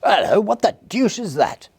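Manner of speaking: very high, loud startled shout in another man's voice; startled cry. A narrator's reading. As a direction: blurt it as a gentleman caught off guard. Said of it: "as he cried out".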